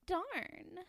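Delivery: high breathy mock flirt; a light breathy starlet sigh; flirty breathy voice. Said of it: "My favorite is still".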